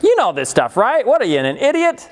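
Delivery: mock needling teenager, pitch climbing at the end; not exactly nice. Fifteen-year-old needles a younger friend over equations, not nicely. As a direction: imitate a tactless older kid. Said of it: "he's not exactly nice about it".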